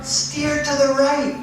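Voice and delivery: high, loud, stretched warning; alarmed father's drawn-out shout; urgent calling out. Acted. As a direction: call down the hill to a child in danger. Father calls out to sledding son.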